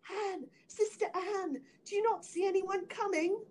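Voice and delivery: anxious cry. A narrator's reading. Wife calls to her sister again, high anxious cry. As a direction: plead upward for news of rescue. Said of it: "and then she cried".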